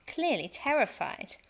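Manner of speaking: ordinary delivery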